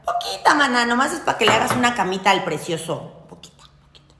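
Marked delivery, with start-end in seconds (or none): 3.3-4.2 whispered, barely audible. Shown as a demonstration. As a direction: breathe it while sprinkling salt.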